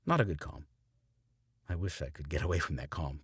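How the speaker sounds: ordinary delivery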